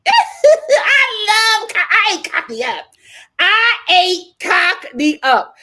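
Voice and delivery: laughing, high, loud shout of delight; gleeful boast. Real, not acted. Host bragging about her Cockney in acting school, gleeful. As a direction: crow it loud, stretching the words.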